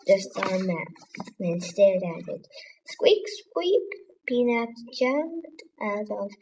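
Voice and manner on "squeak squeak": high squeaky mouse squeaks; two high mouse squeaks. A narrator's reading.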